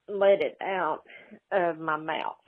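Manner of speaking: ordinary talk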